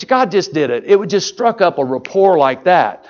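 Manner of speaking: ordinary talk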